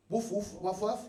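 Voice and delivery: soft little spoken woofs, imitating a dog; cute dog bark. Showing how dogs bark in Luxembourg, cute dog bark. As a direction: bark softly like a harmless dog. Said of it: "It's cute".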